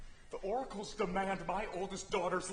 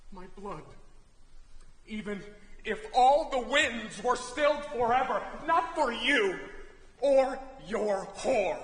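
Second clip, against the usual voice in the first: higher and louder, raging; furious refusal; 4 semitones higher, 6 dB louder, slower than usual. Acted. King raging at his brother, furious. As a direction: spit out the last words slowly.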